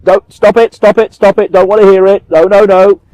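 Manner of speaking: imitating someone refusing to hear; mock panicked denial. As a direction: drown it out like a child with fingers in ears. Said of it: "what they're doing is".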